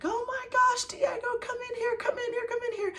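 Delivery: very high-pitched frantic calling out; freaking out. Retold or imitated. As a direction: re-enact an urgent, squealed summons to husband. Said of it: "I started freaking out".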